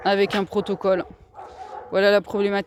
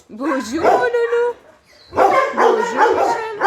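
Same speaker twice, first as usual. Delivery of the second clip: high, sing-song coo to each dog; pet-talk voice, much higher; 12 semitones higher, 4 dB louder than usual. Real, not acted. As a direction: coax the dogs like babies, high and bright. Greets shelter dogs one by one.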